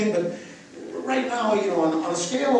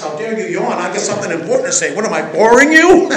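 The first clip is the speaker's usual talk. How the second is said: hammed-up indignant rant, louder and louder; mock outrage; 13 dB louder than usual. Teacher pretending to scold a yawning listener, mock-furious and loud. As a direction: play the offended speaker catching a yawn.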